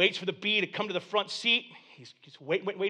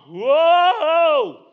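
loud, high swooping spook cry; mock scary devil; 7 semitones higher, 9 dB louder, much slower than usual. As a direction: play a harmless bogeyman hamming it up. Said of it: "the devil can sound scary".

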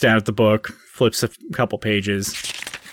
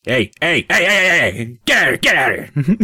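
shooing shout; 5 semitones higher than usual. Acted. Hank shooing Ramig away.

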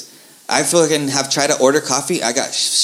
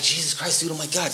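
quietly mumbled inner dismay; muttered dismay; 8 dB quieter than usual. Retold or imitated. Recalling his shock at the counter, quiet muttered dismay. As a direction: say it under your breath, stunned. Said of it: "I was just like".

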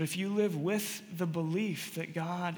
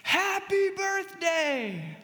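high, drawn-out celebratory yell; long, high mock-crowd cheer; surprise party shout; 11 semitones higher, 4 dB louder, much slower than usual. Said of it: "everyone jumps out and says".

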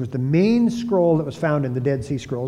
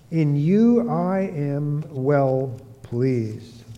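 very slow, stately heavenly pronouncement; voice of God; much slower than usual. Retold or imitated. God telling his beloved Son he is pleased, slow and solemn. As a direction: draw each word out solemnly as God. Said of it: "The voice came out of the heavens".

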